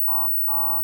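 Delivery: goofy nasal horn honk; silly clown honk. Retold or imitated. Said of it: "worse than a fucking Prius going".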